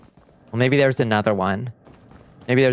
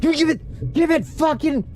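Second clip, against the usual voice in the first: very high, desperate cries; frantic yelling. Real, not acted. Losing her stuff to the void, frantic angry shriek. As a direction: scream as the loot slips away.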